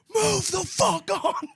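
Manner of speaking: very high, slow, drawn-out mock scream; hammed-up scream of fury at a drunk; screaming in his face. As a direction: stretch each word like a yell into a face. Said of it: "screaming in his face".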